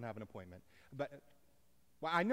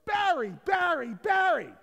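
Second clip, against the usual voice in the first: shouted, high rhythmic fan chant; cheering crowd; 14 dB louder than usual. Retold or imitated. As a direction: yell it out slowly like a stadium crowd. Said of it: "crowds of people cheering my name".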